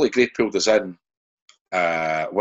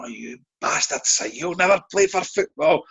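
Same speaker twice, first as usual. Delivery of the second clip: mock outrage; 10 semitones higher, slower than usual. Retold manager's angry reply.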